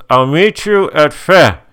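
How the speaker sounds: high, slow, loud mock mumble; garbled high-pitched mom voice; mumbling mom. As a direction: imitate a mom talking through a face mask. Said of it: "she's kind of mumbling".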